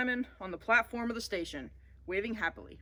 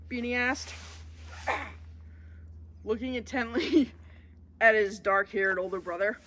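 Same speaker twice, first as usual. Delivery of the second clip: ordinary delivery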